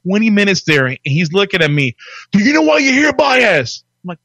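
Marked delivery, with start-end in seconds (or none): 2.3-3.8 high, loud shouted question; drill instructor shout. Retold or imitated. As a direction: yell it in the recruit's face. Drill instructor yells at recruit.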